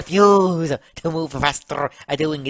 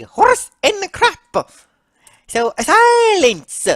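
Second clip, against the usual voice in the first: high, loud, slow pronouncement; dismissive Mario; 9 dB louder, slower than usual. Acted. Mario dismissing Luigi's hopes, scornful. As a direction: silence him with loud, drawn-out high words.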